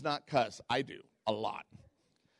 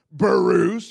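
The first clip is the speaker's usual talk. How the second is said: loud exasperated name; 4 semitones higher, 12 dB louder than usual. Retold or imitated. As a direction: shout the name Bruce in disbelief. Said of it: "I went".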